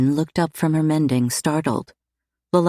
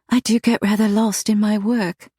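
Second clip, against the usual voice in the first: breathlessly; 5 semitones higher than usual. A narrator's reading. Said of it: "she said breathlessly".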